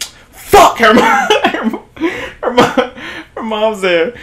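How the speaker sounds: laughing panic. Retold or imitated. Buddy seeing the lights go on, laughing in shock. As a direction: crack up mid-panic. Friend sees lights turn on.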